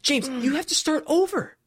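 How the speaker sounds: exasperated laughing rant about being scammed; outraged excitement. Real, not acted. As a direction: tell it like you can't believe you got robbed. Retelling getting scammed in game.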